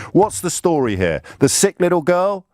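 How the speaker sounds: high-pitched, incredulous list of questions; exasperated rhetorical questions. Real, not acted. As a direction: fire off each option as an indignant question, pitched high. Sarcastic rhetorical questions to critics.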